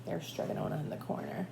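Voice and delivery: soft, fast whispered aside. Real, not acted. Reader points out Stregonona to listening children, whispering.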